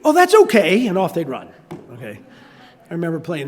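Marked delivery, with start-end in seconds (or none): 0.0-0.9 high, louder hasty dodge; child dodging chores with a quick excuse; kid backing off. Retold or imitated.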